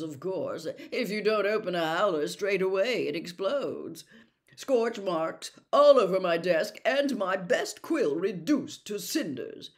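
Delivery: loud, self-important official; pompous Percy. A narrator's reading. Percy boasting to the family about his busy week, pompous. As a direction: boast of your hard work, puffed up.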